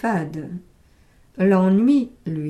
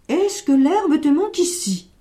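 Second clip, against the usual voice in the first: put-on anxious old man; 5 semitones higher, 4 dB louder than usual. Old man questions his goat.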